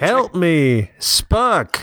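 high, drawn-out mock cry; put-on plea for help. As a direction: stretch it into a silly, high-pitched plea.

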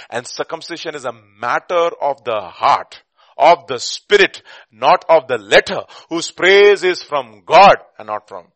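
loud, slow, weighty scripture delivery; forceful preacher pressing the lesson; emphatic preaching. Real, not acted. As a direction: hammer each phrase home, drawing out key words.

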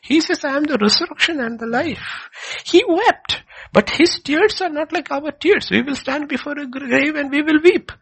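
high, loud fervent preaching; preaching at full force. Real, not acted. Preacher driving his point home to the congregation, fervent and loud.

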